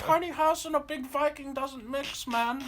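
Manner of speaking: high put-on voice drifting into German; old-man impression slipping into a German accent; slight German accent. Old customer scolds the moving company, high slightly German accent. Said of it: "you turned a little German there".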